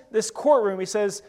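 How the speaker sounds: ordinary talk, nothing marked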